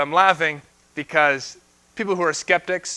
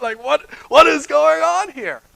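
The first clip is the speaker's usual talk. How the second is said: amazed wonder; 8 semitones higher, 5 dB louder than usual. Retold or imitated. Crowd amazed as food multiplies.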